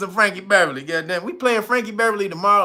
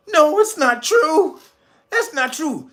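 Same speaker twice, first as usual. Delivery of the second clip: Luke in denial; 7 semitones higher than usual. Retold or imitated. Luke refusing to believe Vader, high and anguished. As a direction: cry it out high in disbelief.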